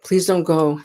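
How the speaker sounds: high, loud pleading that fades toward pitiful begging; hammed-up relatives begging someone not to leave; imitating a grieving family wailing at the shore; mock wailing plea. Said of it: "crying and wailing and rending of garments and screaming".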